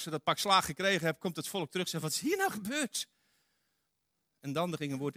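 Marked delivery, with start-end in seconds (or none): 2.0-3.1 high, bewildered outcry; bewildered people. Acted. Beaten people returning to Joshua, high and bewildered. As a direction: ask it like a stunned crowd.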